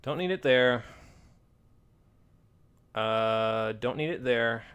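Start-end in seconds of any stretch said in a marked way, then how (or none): none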